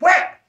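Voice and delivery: very high, very loud knockout hit noise; big whack sound effect. Retold or imitated. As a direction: yell the final blow like a cartoon. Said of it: "you're like".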